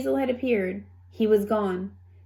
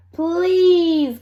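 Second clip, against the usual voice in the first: loud, high call; 8 semitones higher, 8 dB louder than usual. A narrator's reading. Dove calling to the hens, loud call. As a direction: call out to stop the fight. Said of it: "called one of the doves".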